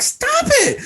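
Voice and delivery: very high-pitched squealed protest; hammed-up high yelp of annoyance; mock indignant yelp. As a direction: squeal it as if being pestered. Acting out being hit, again.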